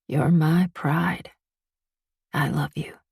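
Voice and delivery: very slow, gentle goodbye; father's final loving farewell, very slow; tender farewell. A narrator's reading.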